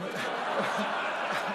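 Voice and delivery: embarrassed laugh at own joke. Real, not acted. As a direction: burst of real laughter.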